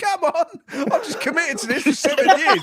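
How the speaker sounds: high, loud, laughing mock Bowen; imitating Bowen. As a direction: squeal it out as a frustrated Bowen, half laughing. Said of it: "He's like".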